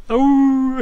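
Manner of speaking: dog howl. Acted. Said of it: "dog howls".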